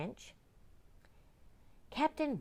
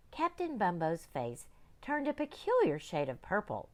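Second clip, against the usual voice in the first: plain reading voice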